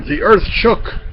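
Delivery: ordinary delivery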